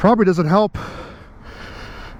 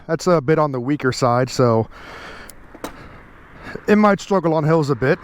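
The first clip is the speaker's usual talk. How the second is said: usual speaking voice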